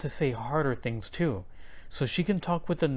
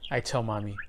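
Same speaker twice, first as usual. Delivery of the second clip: usual speaking voice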